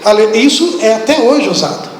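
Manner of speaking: usual speaking voice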